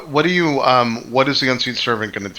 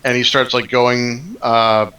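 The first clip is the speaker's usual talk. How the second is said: ordinary delivery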